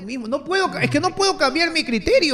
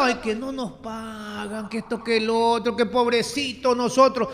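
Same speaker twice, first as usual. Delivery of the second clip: soft, whiny mockery of complainers; hammed-up pitiful whine; mock whining; 8 dB quieter than usual. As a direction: parody their self-pitying moan, quietly. Mocking the whining union.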